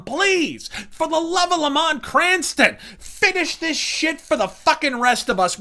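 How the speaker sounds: usual speaking voice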